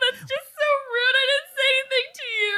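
high, fast mock sobbing; hammed-up wounded whine; fake crying. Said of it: "You cry".

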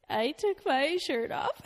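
slow, high and laughing; real embarrassed giggling, drawn out; laughing confession. Real, not acted. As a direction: confess it through rising laughter.

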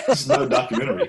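high, fast, laughing through the words. Real, not acted. Teasing Bobby about the film, laughing hard. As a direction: crack up while you say it.

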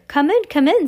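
high, loud impatient order; witch snapping. A narrator's reading. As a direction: snap at them to enter. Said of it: "snapped the Grand High Witch".

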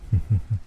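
dry, dismissive chuckle; brief laugh from an unimpressed entity; short, unamused huh-huh-huh. A narrator's reading. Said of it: "It laughed an unimpressed laugh".

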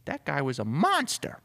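high-pitched woman's voice; female assistant. Acted. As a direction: exclaim as the chief's assistant. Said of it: "She's like".

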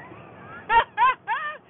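high, loud burst of laughter; hearty laugh. Real, not acted. Storyteller laughs at the family's disbelief, hearty laugh.

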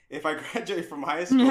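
laughing through it. Retold or imitated. Son asks his parents about art school, laughing. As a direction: laugh mid-word as you ask again. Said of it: "and I said".

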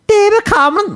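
very high, exaggerated Scouse; squealed mock Scouse; bad Scouse accent. Shown as a demonstration. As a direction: do a deliberately bad Scouse impression. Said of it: "And I did Scouse".

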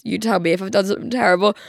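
ordinary delivery

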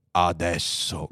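low, calm confirmation; grave computer. Acted. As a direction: confirm it deep and unhurried. (conferma grave e pacata)

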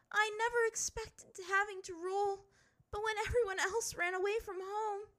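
high, distressed plea; fighting back pain; upset girl. A narrator's reading. Ty Lee upset.

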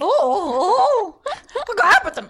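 high, loud mock alarm; hammed-up horror at the change; mock shocked gasp. Captioning the old actor, loud high mock shock.

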